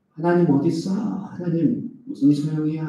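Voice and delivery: a grumbling believer's mock protest; bitter complaint against God. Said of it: "이렇게 번역할 수 있겠죠".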